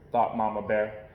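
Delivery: usual speaking voice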